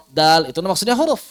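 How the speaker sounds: ordinary delivery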